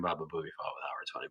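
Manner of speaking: quick hushed whisper. Real, not acted. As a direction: whisper it fast like an aside. Sudden whisper aside.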